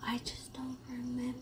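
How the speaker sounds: soft quick whisper. Real, not acted. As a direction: whisper it under your breath. Whispered denial.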